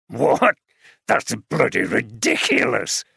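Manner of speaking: scornful. A narrator's reading. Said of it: "Welbeck laughed scornfully".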